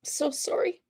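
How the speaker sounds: squeezed, breaking voice fading to whispers; real crying. Real, not acted. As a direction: choke out the apology through tears. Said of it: "I'm gonna cry for you".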